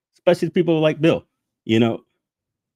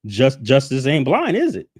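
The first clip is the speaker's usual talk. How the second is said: higher, sharp indignant challenge; 7 semitones higher, 3 dB louder than usual. Real, not acted. Ranting at viewers about the panel, indignant and high. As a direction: push the outrage up high.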